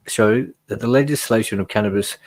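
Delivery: usual speaking voice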